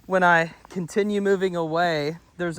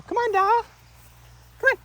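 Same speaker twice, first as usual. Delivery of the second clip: squeaky, much higher encouragement; high dog praise; 4 dB louder than usual. Real, not acted. Trainer coaxes the puppy Doll to follow, squeaking high. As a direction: coax the puppy along in a high, excited squeal.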